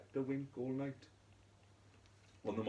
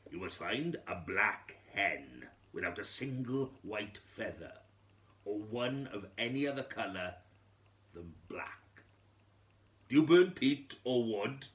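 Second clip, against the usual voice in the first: wise man, louder and commanding; wise man instructing; 2 semitones higher, 9 dB louder than usual. Acted. Wise man telling the widow what to do, louder and commanding. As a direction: lay down the strict instructions like an old sage.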